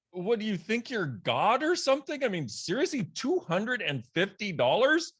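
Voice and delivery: outraged squeal building at the price; incredulous outrage. Retold or imitated. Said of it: "an amount that I was like".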